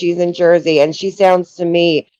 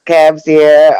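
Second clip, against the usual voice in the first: louder imitation Jersey accent; mock Jersey accent; 5 dB louder than usual. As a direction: echo it in a put-on Jersey accent. Echoes the fake Jersey accent.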